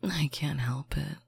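ordinary talk, nothing marked

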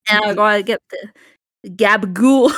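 hammed-up New York Italian accent; shitty New York accent. Joining a joke about New York errands, hammed-up New York accent. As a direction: ham it up in bad New York. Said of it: "a really shitty New York accent".

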